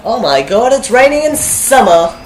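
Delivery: real surprise at summer rain; loud high disbelief about the weather; amazed exclamation. Real, not acted.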